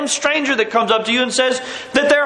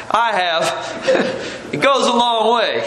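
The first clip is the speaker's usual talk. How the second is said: ordinary talk, nothing marked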